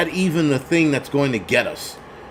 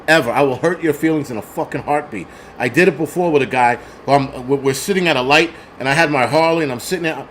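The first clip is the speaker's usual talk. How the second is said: normal talking voice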